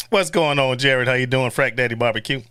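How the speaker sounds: ordinary delivery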